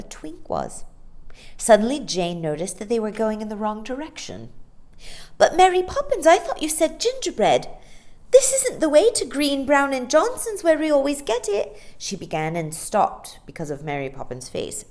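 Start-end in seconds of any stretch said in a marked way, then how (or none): 5.4-11.8 put-on high, loud eager girl's voice; puzzled girl. Girl telling the nanny they are going the wrong way. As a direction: question her, a little confused.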